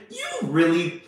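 usual speaking voice

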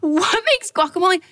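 high, incredulous squeal at the price; mock-horrified over the guacamole; outraged disbelief. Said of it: "I was like".

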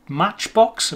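ordinary talk

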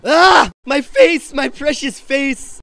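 high mock-agonized yelp; pained cry. Shaman clutching his face after the attack, hammed-up wail. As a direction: wail over the face as if it were treasure.

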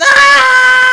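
high piercing shriek; terrified scream. Real, not acted. Player shrieks as something attacks. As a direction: shriek in real fright. Jump scare in game.

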